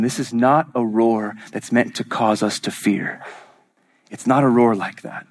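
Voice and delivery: ordinary delivery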